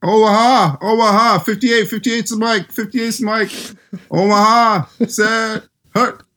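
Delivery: mock Manning shouting Omaha at the line; hammed-up quarterback calling the play; Manning impression. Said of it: "how about Manning?".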